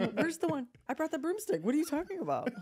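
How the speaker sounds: high-pitched feigned bafflement; hammed-up indignant denial; mock gaslighting. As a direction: act loudly clueless, as if innocent. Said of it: "start gaslighting her".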